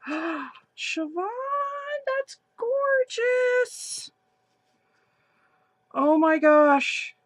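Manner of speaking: high, slow, drawn-out gasp of delight. Real, not acted. Thrilled over a friend's scarf, gasping delight. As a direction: gasp and squeal as if the gift floored you.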